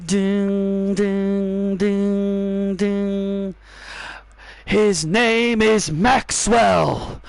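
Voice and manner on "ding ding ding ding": singing bell tones to open the song; mock bell dings. Imitating a bell.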